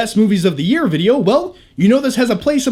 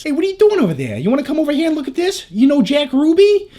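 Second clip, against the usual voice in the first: put-on higher, fast Boston tough-guy accent; 7 semitones higher than usual. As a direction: throw on a quick Boston street accent. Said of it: "I just love the accents".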